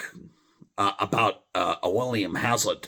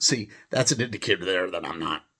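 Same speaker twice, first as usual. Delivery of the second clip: ordinary talk, nothing marked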